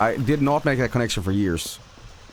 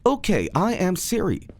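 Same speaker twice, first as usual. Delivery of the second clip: slow robotic assistant, pitched up; measured synthetic helper voice, higher; Siri; 10 semitones higher than usual. Acted. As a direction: talk like a modern virtual assistant. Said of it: "talk like a robot".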